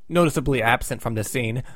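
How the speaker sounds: ordinary talk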